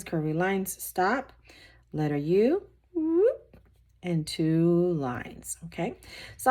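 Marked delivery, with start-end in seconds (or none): 2.9-3.6 high little "whoop" as the pen swoops; playful whoop. Real, not acted. Sound effect for drawing a curve.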